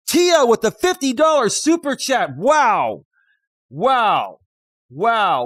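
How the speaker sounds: loud, high burst of delight; overjoyed, shouting thanks; excited shout. Real, not acted. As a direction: yell it out, thrilled by the gift. Streamer gets a fifty dollar donation.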